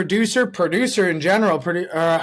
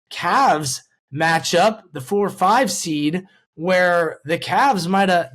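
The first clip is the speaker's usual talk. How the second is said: ordinary talk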